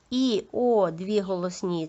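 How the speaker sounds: ordinary talk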